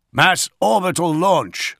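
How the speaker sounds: high, loud, slow announcement; urgent report. A narrator's reading. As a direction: report it as an emergency. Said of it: "Augustin reported".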